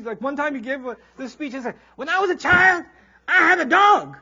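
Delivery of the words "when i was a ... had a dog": high, loud booming preacher delivery; hammed-up pulpit pounding, high and loud; fiery preacher. Imitating the imam's khutbah to the crowd, mock booming preacher.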